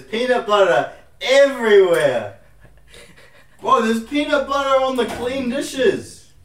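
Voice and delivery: loud, high, slow outburst; angry shout. Real, not acted. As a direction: yell in real frustration. Peanut butter spilled on dishes.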